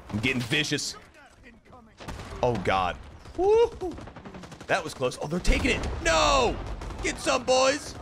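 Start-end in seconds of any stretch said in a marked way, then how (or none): 3.4-4.0 very high, loud woo-hoo; whooping cheer. Real, not acted. Gamer whooping after a narrow escape. 6.0-7.9 desperate shout. Real, not acted. Gamer yelling as enemies take the point. Enemy taking the objective.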